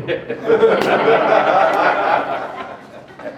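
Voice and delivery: long, loud unvoiced laugh; laughing hard at the memory. Real, not acted. Laughing at his own story.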